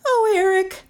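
high-pitched plea; frightened cry. A narrator's reading. Melissa clinging in the dark, high cry. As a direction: call to him in high alarm. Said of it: "Melissa cried".

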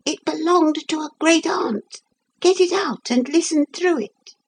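very high-pitched tiny figure; little voice. A narrator's reading. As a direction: pipe up in a tiny voice. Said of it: "he could just hear the teeny".

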